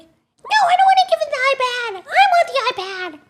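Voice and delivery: very high, loud mock tantrum; bratty kid refusing. Son refusing to give his brother the iPad, tantrum. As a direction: whine and yell like a child.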